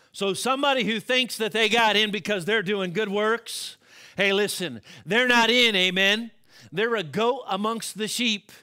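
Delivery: loud, high, ringing sermon delivery; preaching. Acted. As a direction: preach it out to the whole church. Preaching scripture and point.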